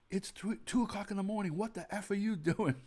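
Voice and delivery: mock angry wife, pitched well up; imitating her two a.m. yelling; a hammed-up echo of his wife screaming at him. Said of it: "She was screaming at me".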